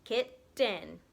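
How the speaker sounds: "Kitten" broken into kit and ten; two distinct beats on "Kitten"; split into syllables. Shown as a demonstration. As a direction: demonstrate "Kitten" with a gap between the syllables. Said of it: "That's two syllables".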